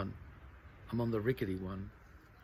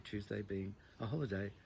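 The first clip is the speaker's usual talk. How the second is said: usual speaking voice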